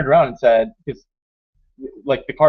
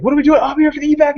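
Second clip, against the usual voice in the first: high, loud hurried protest; imitated urgent player; 13 semitones higher, 5 dB louder than usual. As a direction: act out someone frantic to be evacuated. Retold player in a hurry.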